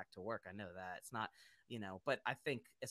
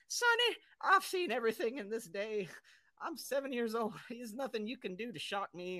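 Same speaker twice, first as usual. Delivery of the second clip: put-on higher old-timer voice; old man voice; 10 semitones higher, 7 dB louder than usual. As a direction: play an unshockable old-timer, pitched up. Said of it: "they probably be like".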